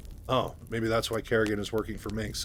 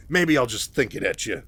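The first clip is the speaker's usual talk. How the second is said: sassy, louder comeback; Raynor, sassy; 6 dB louder than usual. Retold or imitated. Raynor needles Kerrigan after her rebuke, sassy. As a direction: toss it back with sass. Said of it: "still being a sassy bitch".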